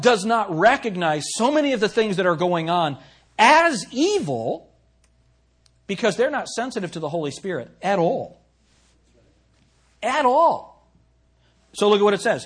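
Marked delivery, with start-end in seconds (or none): 3.4-4.7 "as evil" drawn out very slow and higher; stress on evil. Acted. Emphasizes the key word. 10.0-10.9 "At all" pitched high and punched loud; emphatic repeat. Acted.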